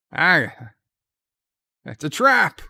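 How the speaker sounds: mock cry of alarm, high and loud. Warning as the ambush springs on Quark, mock alarmed cry. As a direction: call out a hammed-up warning.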